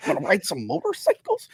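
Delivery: soft, mumbly imitation of Tom Hardy's American accent; Venom-style Tom Hardy American accent, hushed and lazy; mock Tom Hardy American accent. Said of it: "his American accent's like".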